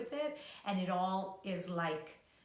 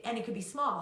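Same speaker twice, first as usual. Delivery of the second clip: ordinary delivery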